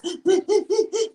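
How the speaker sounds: high bright burst of laughter; embarrassed laugh. Real, not acted. Laughing at own choked feeling.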